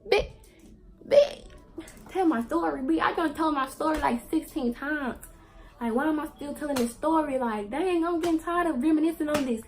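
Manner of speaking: loud, high, fed-up rant; fed up at telling the story again, loud and high; exasperated. Real, not acted. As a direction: vent like you're sick of it. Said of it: "annoyed at retelling".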